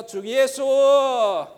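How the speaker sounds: pray-read the verse aloud with longing; slow fervent prayer voice; fervent prayer demo. Shown as a demonstration. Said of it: "比如说呢".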